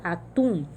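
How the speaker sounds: atum, leaning on final TUM; última sílaba forte. Shown as a demonstration. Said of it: "o tum é mais forte". (atum, com o TUM mais forte)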